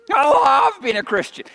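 preacher shouting his faith; shouted testimony. Acted. Same line repeated shouted.

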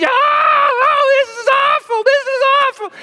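high, loud mock hysterical wailing; a panicked actress, hammed-up and shrieking; hysterical woman. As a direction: ham up a woman coming unglued. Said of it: "she is coming unglued".

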